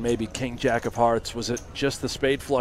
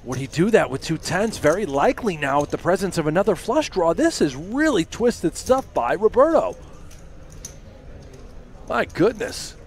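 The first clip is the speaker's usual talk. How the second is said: rising, louder disbelief; excited; 6 semitones higher, 4 dB louder than usual. Real, not acted. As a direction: build up stunned excitement. Stunned by a huge shove.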